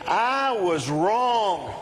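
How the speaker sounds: high, stretched-out words for repeating; congregational call-out. Shown as a demonstration. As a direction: lead the crowd in slow, emphatic declarations. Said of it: "Look at someone next to you and say".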